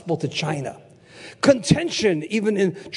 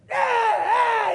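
long, high imitated shriek; demonic scream. Preacher imitating the man screaming behind him, demonic scream. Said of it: "screaming like a demon".

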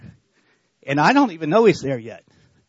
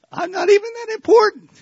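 much higher, squeaky falsetto on I'm not even that important; jokey falsetto brushing off praise; mock humble squeak; 7 dB louder than usual. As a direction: squeak it out like it's a silly joke. Mock-humble quote while laughing.

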